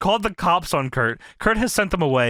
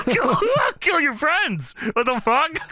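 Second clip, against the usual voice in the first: laughing mock retort; 8 semitones higher than usual. Mocking Sting's threat.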